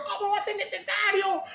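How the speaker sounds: mock outraged disbelief; incredulous protest. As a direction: protest in shock. Protesting to Jesus.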